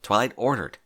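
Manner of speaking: plain reading voice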